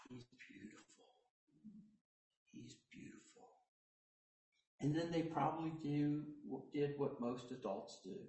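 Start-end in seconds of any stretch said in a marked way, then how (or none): 0.1-3.5 breathless whisper of wonder; awed whisper. Retold or imitated. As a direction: whisper it, gazing at a baby. Shepherds admiring the baby.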